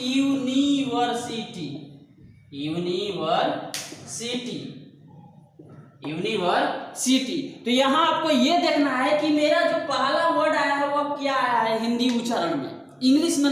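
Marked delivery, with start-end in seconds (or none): 0.1-7.4 यूनिवर्सिटी sounded out slowly as यू-नि-वर-सिटी; Hindi pronunciation. Shown as a demonstration. Teacher reads यूनिवर्सिटी by its Hindi sounds, slowly syllable by syllable. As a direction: stretch यूनिवर्सिटी part by part, starting with a clear "yu". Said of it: "तो यहाँ जो पढ़ते हैं हम लोग हिंदी".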